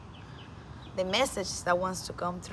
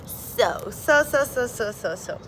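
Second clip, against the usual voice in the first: laughing; 4 semitones higher, 4 dB louder than usual. Real, not acted. Giggling, stuck on words.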